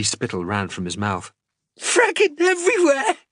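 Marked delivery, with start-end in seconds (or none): 1.8-3.2 very high, loud sobbing cry. Acted. Xander answers the orderly, sobbing in pain. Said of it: "He sobbed".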